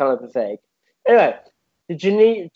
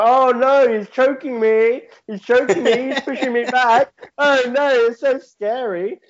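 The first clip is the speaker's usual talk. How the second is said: high hammed-up panic, slow at the end; mock terrified victim; 8 semitones higher than usual. Bystanders pretending to be choked, hammed-up fake panic.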